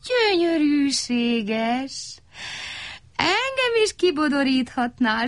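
high, slow, dreamy longing; wistful sigh. A narrator's reading. Little crow Szeréna longing to be curled too, wistful. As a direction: sigh it as a wish. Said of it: "sóhajtott Szeréna".

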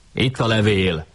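lightly voiced cry of discovery; a touch of an excited call; mild exclamation. A narrator's reading. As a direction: call it out without full volume. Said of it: "kiáltott fel Andrási".

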